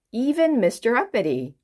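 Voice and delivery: ordinary delivery, nothing marked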